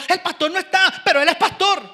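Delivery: high, loud mock call; hammed-up shout from the crowd; imitated bystander. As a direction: call it out as an excited onlooker. Voices a bystander calling out.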